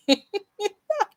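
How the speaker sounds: short high-pitched giggle. Real, not acted. Laughs at own yawning.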